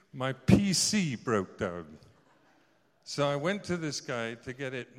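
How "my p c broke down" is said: slow and much louder, every word punched; emphatic correction. Real, not acted. Preacher correcting his slip to the congregation, slow and loud. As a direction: make the correction unmistakable.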